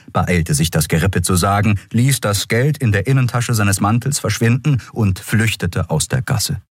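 ordinary delivery, nothing marked

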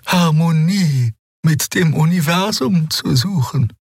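high, reverent and slow; awed young healer. A narrator's reading. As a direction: speak as if meeting a legend. Xea explains her faith.

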